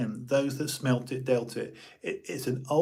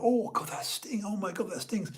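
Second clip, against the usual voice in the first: higher, hammed-up disgust; clownish mock disgust; 7 semitones higher, 4 dB quieter than usual. Schoolboy making a scene about a smell, hammed-up disgust. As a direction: play it up like a clown. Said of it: "you would like a clown".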